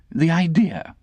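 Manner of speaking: high affected lady, yawning; stifled yawn. Acted. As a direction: scoff it while swallowing a yawn. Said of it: "checking a yawn".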